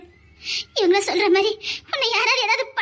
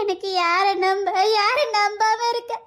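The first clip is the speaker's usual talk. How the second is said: tearful lament. Acted. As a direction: wail and beg him to wake. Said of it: "இப்ப பைத்தியக்காரி மாதிரி புலம்பியதுக்கு". (அழுகையோடு மெதுவாக புலம்பல்)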